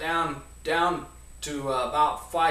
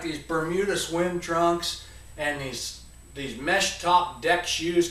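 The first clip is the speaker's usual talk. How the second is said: normal talking voice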